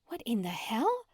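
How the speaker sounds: whispered in disbelief; hushed shock; stunned whisper. Acted. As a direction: breathe it out, shocked. Stunned at the crowded booth.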